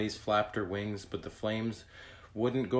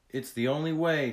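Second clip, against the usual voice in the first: higher, louder, pressing; urgent insistence; 6 semitones higher, 5 dB louder than usual. A narrator's reading. Tag persuades frightened Skyla to ride, urgent insistence. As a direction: insist there is no choice. Urging frightened friend to flee.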